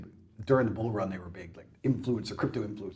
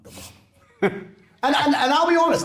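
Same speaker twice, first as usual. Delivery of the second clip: laughing; 9 semitones higher, slower than usual. Real, not acted. Laughing at himself.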